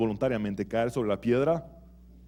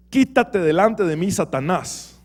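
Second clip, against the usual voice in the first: stern rebuke; 6 semitones higher, 6 dB louder, slower than usual. Retold or imitated. Jesús rebukes Pedro.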